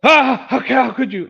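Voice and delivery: loud, high mock gasp of betrayal; hammed-up affronted cry; mock outrage. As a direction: act playfully scandalized, high and loud. Playful mock outrage after birthday wish.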